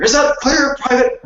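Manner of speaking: barking military drill sergeant, high and loud. Pretend. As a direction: bark it like a drill instructor. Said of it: "I feel like I'm wearing, like, a military gear".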